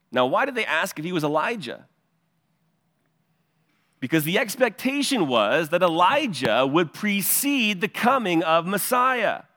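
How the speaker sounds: ordinary talk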